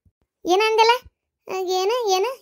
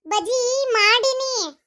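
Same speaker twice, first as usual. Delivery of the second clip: slow, drawn out; 6 semitones higher, much slower than usual. Acted. Repeats for deaf grandma.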